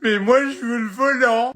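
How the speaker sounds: put-on high, slow whiny kid; whiny child voice. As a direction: whine it like a spoiled child. Kid whines for the wheel again.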